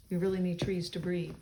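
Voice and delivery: ordinary delivery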